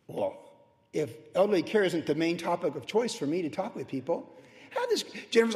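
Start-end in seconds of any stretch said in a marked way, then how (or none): none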